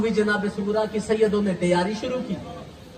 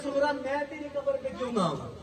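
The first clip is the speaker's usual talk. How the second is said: crying; 3 semitones higher, 9 dB quieter than usual. Acted. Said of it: "रो के कहता है".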